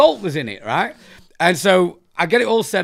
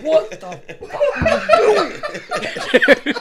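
very high, drawn-out squeal through laughter; slow, high-pitched mock horror; retold disbelief; much slower than usual. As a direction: imitate an aghast friend, stretched and laughing. Retold friend's shock, laughing.

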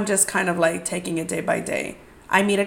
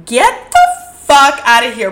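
louder, punched yell at the guy; loud retold yell; 4 semitones higher, 9 dB louder than usual. Yelling at a pushy date to get out, loud. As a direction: throw it at him full volume. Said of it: "really loud".

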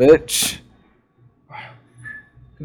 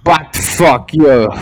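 loud, slow mock Russian curse; Russian accent; 3 semitones higher, 10 dB louder, slower than usual. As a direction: punch each word in fake Russian. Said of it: "In Russian, you say".

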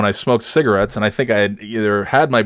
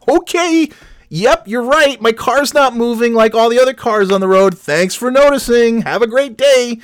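mock sarcasm; 9 semitones higher than usual. Stranded driver thanks the stranger, high sugary sarcasm. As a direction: thank him with fake sweetness.

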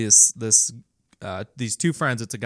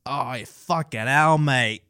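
put-on slow Aussie-accented cursing; Australian accent; slower than usual. Slipping into podcast speech, drawn-out Australian accent. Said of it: "in Australian or, like, an Australian phrase".

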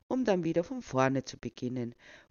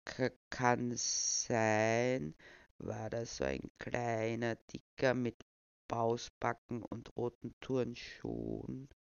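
very slow, softer stammering; put-on old man, slow and soft; feeble old voice; 3 semitones lower, 3 dB quieter, slower than usual. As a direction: dawdle over the description. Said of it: "den altersschwachen Tonfall beizubehalten".

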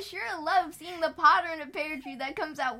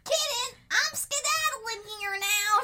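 high squeaky rat voice; twitchy squeaking rat voice; 8 semitones higher than usual. Acted. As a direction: squeak it like a nervous rat fleeing.

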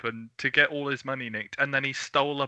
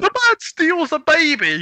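mock outrage; 10 dB louder than usual. Mock outrage at the book plot.

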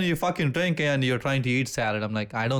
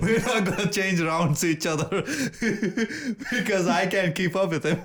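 high-pitched, laughing delivery; amused, voice riding up on laughter; laughing while talking; 8 semitones higher than usual. Real, not acted. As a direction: say it through real giggles. Talking through laughter with friend.

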